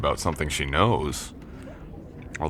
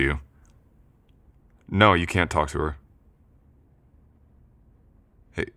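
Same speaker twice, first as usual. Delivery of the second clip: firm, menacing warning to the ex; steady threatening phone voice, protective; scary on the phone; 3 dB louder than usual. Acted. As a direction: lean on him hard but keep control. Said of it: "I've been told I can sound pretty scary".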